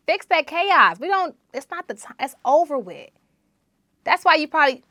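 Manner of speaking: high, loud scolding rant; fired-up rant. Real, not acted. Host scolding women who bring chaos home, loud rant. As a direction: scold the listener with rising heat.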